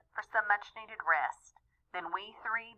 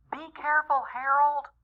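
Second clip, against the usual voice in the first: a higher, urgent call to Harold; a lightly voiced worried shout; mild mock scream; 7 semitones higher than usual. Mom calling up to dad on the ladder, mock alarm. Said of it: "I heard my mom scream".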